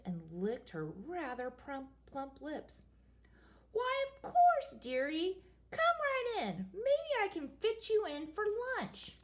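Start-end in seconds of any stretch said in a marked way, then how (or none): 3.7-9.2 high, sugary witch voice; sweet creepy witch. Acted. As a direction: lure him in like a hungry witch. Hungry witch invites prince.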